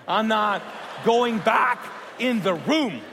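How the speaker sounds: loud, high, frantic refusal; panicked yell. Retold or imitated. Refusing to go back in the room, a loud frantic yell. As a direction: yell it like you're terrified to go back.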